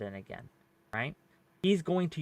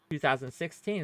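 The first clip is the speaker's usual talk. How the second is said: normal talking voice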